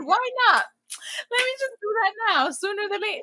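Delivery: high, eager self-pep; bright high why-not pep talk; excited. Retold or imitated. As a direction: pump yourself up. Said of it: "that I said".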